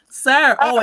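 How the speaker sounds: loud, high call to greet the guest; loud surprised welcome. Real, not acted.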